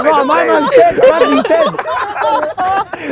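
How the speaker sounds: high-pitched retold disbelief, half laughing; mock shock. Rapper retells believing a soap death was real, mock shock.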